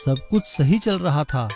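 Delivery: ordinary delivery, nothing marked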